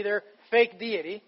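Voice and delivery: normal talking voice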